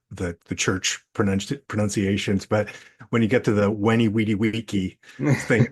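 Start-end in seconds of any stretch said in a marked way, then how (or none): 3.7-5.0 "Weni Weedy Wiki" with every V as W; hard K in "Wiki", W starting each word; classical Latin pronunciation. Shown as a demonstration. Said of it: "the classic pronunciations".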